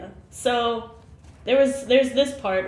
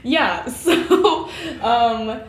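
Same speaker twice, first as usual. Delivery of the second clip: laughing; 5 semitones higher, 6 dB louder, much slower than usual. Real, not acted. Laughs while answering.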